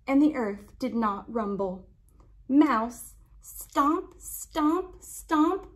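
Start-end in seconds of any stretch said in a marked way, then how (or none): none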